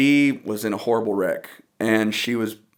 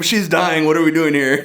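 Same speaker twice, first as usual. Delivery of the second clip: higher, louder mock protest with laughter; amused mock dismay; 5 semitones higher, 5 dB louder than usual. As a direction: act out an exasperated kid, close to laughing. Acts out bored kid, laughing.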